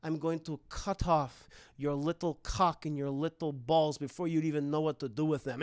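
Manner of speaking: low, measured threat; cold, low villain voice; menacing girl villain. Acted. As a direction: threaten him coldly, without raising the voice. Esther threatens the boy.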